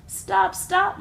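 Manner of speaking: high, loud pleading scream. A narrator's reading. Little sister screams at the glunk, high and loud. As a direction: scream at him to stop. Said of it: "my little sister screamed".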